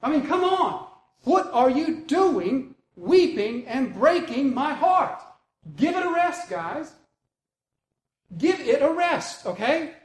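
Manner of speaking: high-pitched exasperation, drawn out; fed-up pleading. Acted. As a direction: tell friends off, at the end of patience. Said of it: "He finally breaks. Then Paul answered".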